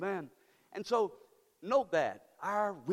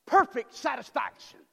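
father David proclaiming; 9 dB louder, slower than usual. Retold or imitated. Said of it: "David says".

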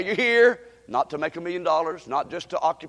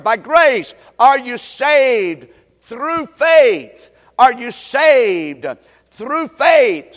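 loud, high, drawn-out key phrases; preaching scripture; 5 semitones higher, 11 dB louder, slower than usual. Acted. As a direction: proclaim each phrase slowly for the congregation. Proclaims scripture for congregation to repeat.

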